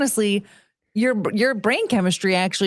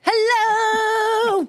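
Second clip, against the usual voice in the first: high squeaky hello; 13 semitones higher than usual. Pretend. Greeting in high put-on voice.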